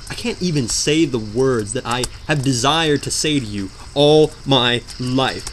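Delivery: ordinary talk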